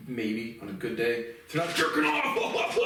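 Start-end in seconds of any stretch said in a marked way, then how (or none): 1.5-2.8 playful yelled aside; mock shout. Real, not acted. Sudden shouted punchline.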